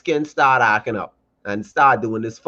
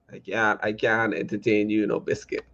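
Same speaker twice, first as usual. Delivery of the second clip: hushed refusal; 6 semitones lower, 9 dB quieter than usual. Real, not acted. Playful refusal.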